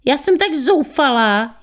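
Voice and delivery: a hammed-up despairing wail; shrieking. A narrator's reading. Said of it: "ječela".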